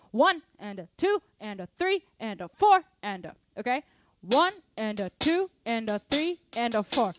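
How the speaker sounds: loud, rising calls of one, and a two over the drums; counting the beat aloud. Acted. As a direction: call out each beat so the class can follow. Counting out loud while drumming.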